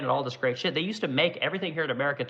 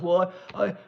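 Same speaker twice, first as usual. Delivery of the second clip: high, put-on voice of a panicked executive; whiny CEO impression; 6 semitones higher than usual. Streamer mocks a furniture CEO hit by tariffs, high whiny voice. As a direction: play the CEO, higher and pleading, whining about tariffs. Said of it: "the C E O of Restoration Hardware says".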